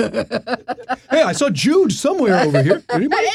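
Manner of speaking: high, laughing mock call-out. Joking fan looking for [name], high and laughing.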